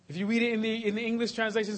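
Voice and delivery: usual speaking voice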